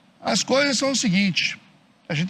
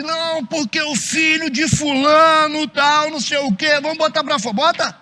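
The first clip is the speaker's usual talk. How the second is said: high, loud, slow condemning shout; mock shouting churchgoer; 7 semitones higher, 6 dB louder, slower than usual. Preacher mocking a judgmental member, loud and high. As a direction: yell to throw the sinner out. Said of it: "o cara que fala assim".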